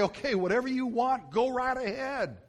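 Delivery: high-pitched, hurried-to-yield surrender; shrinking back. Acted. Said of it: "I'm gonna shrink back and say".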